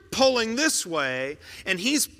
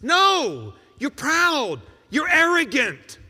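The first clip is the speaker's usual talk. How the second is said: louder and higher, flatly correcting; forceful rebuttal; 7 semitones higher, 5 dB louder, slower than usual. Acted. Preacher rejecting a listener's excuse, forceful and raised. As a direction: cut through the excuse.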